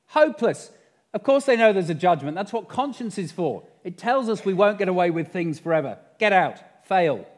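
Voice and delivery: high, loud outburst of scorn; exasperated boss yelling a dismissal; furious senior devil. Acted. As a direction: shout down a hopeless underling and throw them out. Senior devil rejects second answer.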